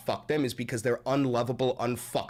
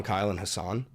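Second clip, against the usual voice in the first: normal talking voice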